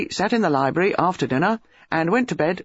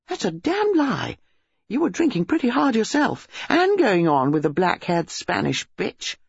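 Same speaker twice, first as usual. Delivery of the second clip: put-on high, furious young woman; indignant; 5 semitones higher than usual. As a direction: hit back hotly at the boyfriend. Acted lovers' quarrel.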